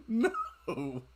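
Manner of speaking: ordinary talk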